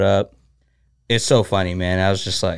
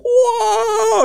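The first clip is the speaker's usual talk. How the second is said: a high-pitched imitation of Zombie's yell, a drawn-out whoa; imitated scream. Retelling a sparring partner's wild scream while laughing. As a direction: mimic the fighter's wild scream when he gets hit. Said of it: "why are you screaming like that".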